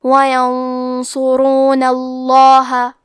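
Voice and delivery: اللَّهَ with a thick, full ل after the ضمة, slow; وَيَنصُرُونَ اللَّهَ slowly, heavy ل; heavy lam. Shown as a demonstration. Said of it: "لام کو موٹی آواز میں پڑھنا".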